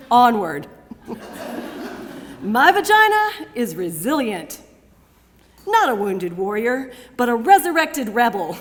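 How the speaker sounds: loud, lifted rallying delivery, building to drawn-out repeats; triumphant spoken-word declamation. Acted. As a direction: proclaim survival to the whole room. Triumphant declamation before audience.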